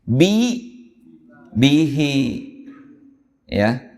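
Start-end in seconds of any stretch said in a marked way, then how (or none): 1.6-2.2 bihi in a recitation voice; recited example. Shown as a demonstration. Teacher gives a second example in recitation voice. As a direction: chant out bihi as in the Quran.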